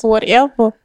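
ordinary delivery

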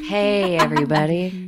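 loud smooth late-night host greeting; sultry radio intro. Pretend. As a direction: purr it like a jazz DJ. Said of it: "I'm gonna add some, like, jazz music undertone to your introduction".

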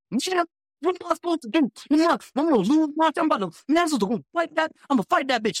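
high, heated rant; mock furious driver. Driver venting to the manager about the customer, heated and high. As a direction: voice the driver boiling over.

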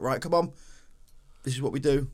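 rallying the team. Retold or imitated. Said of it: "he just was like".

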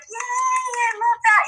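high mock cheer of praise; fake enthusiasm. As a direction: cheer like a mom humoring a child. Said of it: "you have to be like".

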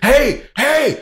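high, loud "hey" at an intruder; challenging shout. Shown as a demonstration. Homeowner bursting out at gate jiggler, high loud challenge. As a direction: burst out and challenge whoever is there. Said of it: "I burst out".

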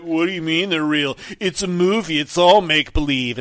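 loud, condescending mock voice; mocking condescension. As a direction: talk down to them loudly. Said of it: "where they're like".

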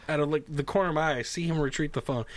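ordinary talk, nothing marked

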